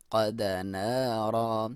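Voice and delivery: slow قد نارا with a full, heavy qaf; slow tajweed demo. Shown as a demonstration. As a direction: recite قد نارا slowly, qaf thick. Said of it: "قاف کو موٹا".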